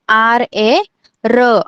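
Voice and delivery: आर, ए, pause, then a firm र; spelled-out pronunciation. Shown as a demonstration. Teacher showing how र is pronounced, spelled out firmly. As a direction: sound out आर ए र in separate beats. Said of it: "र को कैसे pronounce करना है".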